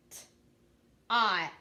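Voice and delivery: "T-ot" with separated sounds and long vowel; sounded out. Shown as a demonstration. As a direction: sound out "T-ot" piece by piece. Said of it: "I'm gonna sound out those words".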